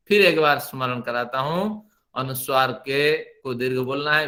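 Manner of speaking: ordinary talk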